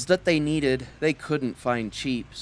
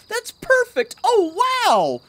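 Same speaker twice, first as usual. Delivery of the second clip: thrilled; 4 dB louder, slower than usual. Acted. Cow thrilled by idea.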